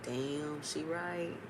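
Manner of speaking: very soft, low aside; retold quiet, awed reaction; hushed concession. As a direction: concede it under your breath.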